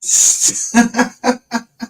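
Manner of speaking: airy disbelieving laugh; breathy laugh. Real, not acted. Streamer cracking up at his own rant, airy laugh. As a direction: let a silent wheezing laugh out.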